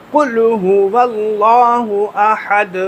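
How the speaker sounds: slow, careful tajweed on قل هو اللہ احد; correct recitation. Shown as a demonstration. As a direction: chant it slowly, each sound full. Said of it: "یہ صحیح طریقہ ہے".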